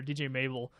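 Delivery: ordinary delivery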